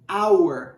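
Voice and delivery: "hour" said as if the H were missing; silent H. Shown as a demonstration. Teacher showing the silent letter, silent H in "hour". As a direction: start "hour" straight on the vowel, H silent. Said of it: "É como se o H não existisse".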